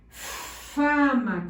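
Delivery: "fama" opening on a drawn-out hissing f; long hissed f. Shown as a demonstration. Teacher dictates with the angry-cat f, "fama" with long hissed f. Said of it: "con la f del gato enojado".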